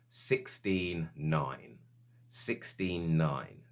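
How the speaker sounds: slow, measured pronouncement in his own voice; the voice he heard. Retold or imitated. Man relaying the voice heard in the room, slow and deliberate. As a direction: say it slowly and evenly, each word drawn out. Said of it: "And all it said was".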